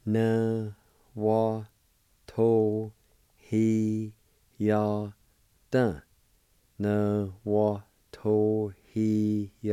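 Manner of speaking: Tohiada stretched out slowly, each sound held. Shown as a demonstration. Teacher modelling a word slowly for the class. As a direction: draw Tohiada out carefully. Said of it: "It's pronounced like this".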